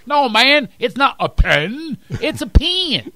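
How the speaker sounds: high, loud mock Texan correcting a Philly accent; imitated accent argument. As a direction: drawl out both accents' 'pen', hammed up. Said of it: "he imitates me".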